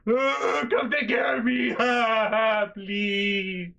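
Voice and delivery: high, loud fake sobbing plea; hammed-up crying for mom, laughing through it; mock bawling. Mocking a grown-up calling mom at work, fake sobbing. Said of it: "bawling my eyes out".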